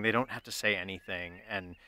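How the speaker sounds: ordinary delivery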